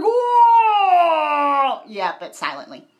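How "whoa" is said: loud, high, drawn-out falling whoa; students' whoa. Acted. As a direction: holler a crowd's long whoa. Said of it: "all the students are like".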